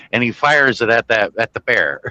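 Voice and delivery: ordinary delivery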